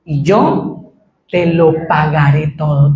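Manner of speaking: slow, low humble vow; pleading servant. Retold or imitated. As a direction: plead the promise slowly. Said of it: "Qué dice al final del versículo".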